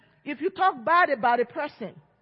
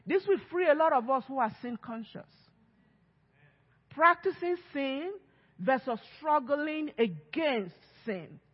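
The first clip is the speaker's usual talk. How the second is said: usual speaking voice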